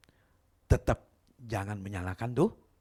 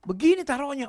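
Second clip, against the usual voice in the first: high, loud instruction; boss instructing; 7 dB louder than usual. Retold or imitated. Boss showing the maid how, loud and high. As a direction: tell her firmly.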